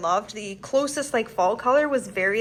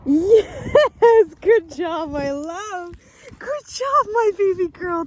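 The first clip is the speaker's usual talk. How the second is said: very high, slow gushing praise; 8 semitones higher, slower than usual. Real, not acted. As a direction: gush over a good puppy. Praising her dog after recall.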